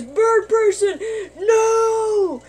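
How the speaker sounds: high, loud cry of despair; anguished shout. Retold or imitated. Rick screams as his friend is shot, anguished. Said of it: "And then Rick goes".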